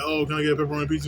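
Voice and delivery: mocking quick customer. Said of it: "be like".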